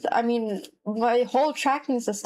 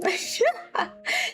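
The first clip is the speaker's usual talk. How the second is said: high laugh; 10 semitones higher than usual. Real, not acted. Burst of laughter.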